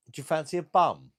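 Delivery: high and loud, teasing proposition; loud cheeky offer. Acted. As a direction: call it out cheekily to the chat. Cheeky proposition to chat viewer.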